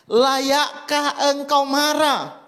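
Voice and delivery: preacher voicing God's question to Jonah; God asking. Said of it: "Lihat ayat sembilan".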